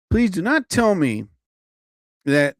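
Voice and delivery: usual speaking voice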